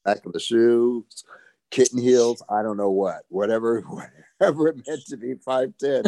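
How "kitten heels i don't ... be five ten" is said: laughing. Real, not acted. Laughs at height trick.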